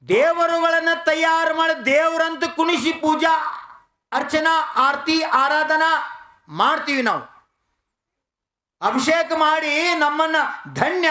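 high, sustained sermon declamation; impassioned oratory to a congregation; full-force preaching. Real, not acted. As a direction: hold each phrase up high and ringing. Preaches on man-made gods.

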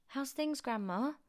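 put-on high-pitched boy's question; lightly voiced boy. A narrator's reading. George checks on his silent grandma, boyish question. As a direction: ask it high, like a young boy.

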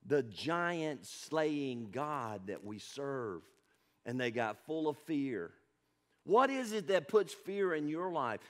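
ordinary talk

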